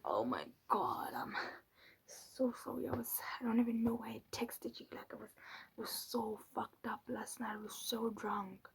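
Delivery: put-on hushed, apologetic drunk-text voice note; sheepish whisper. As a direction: mumble an embarrassed apology under your breath. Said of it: "Then he sends me a voice note".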